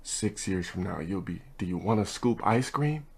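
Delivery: hushed low aside into the ear; a soft, secretive murmur; whisper. Retold or imitated. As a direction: lean in and say it under the breath. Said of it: "I'ma whisper in his ear".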